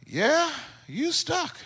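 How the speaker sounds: high, slow, drawn-out dad verdict; deadpan confirmation. Retold or imitated. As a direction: draw it out as the father sizing things up. Father finally looking at stuck son.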